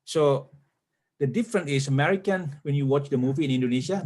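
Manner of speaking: ordinary talk, nothing marked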